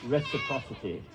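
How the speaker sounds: "reciprocity" as "RESS-a-PROSS-ity", British style; mock-British "reciprocity", each syllable in place; British pronunciation. Shown as a demonstration. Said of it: "I gotta say it like the British".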